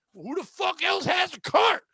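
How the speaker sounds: high, slow, indignant comeback; retold yelling. Passenger answering about the cart on the bus, imitated yelling. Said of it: "They're just over my head yelling".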